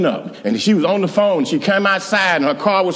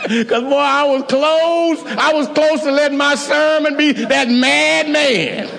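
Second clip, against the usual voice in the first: excited; 9 semitones higher, slower than usual. Real, not acted. Preaching climax, raised voice.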